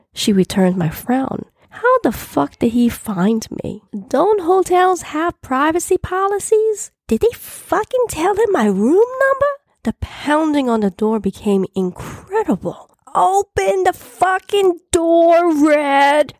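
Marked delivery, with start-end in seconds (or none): 1.7-9.5 high-pitched, incredulous outrage; acted indignant questions, voice shooting up; indignant outburst. Alarmed outburst at being found. 13.1-16.3 high, drawn-out angry yell; yelling through door. Acted. Man yells through the door.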